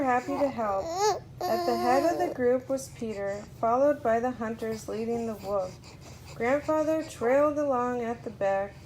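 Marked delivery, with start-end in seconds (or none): none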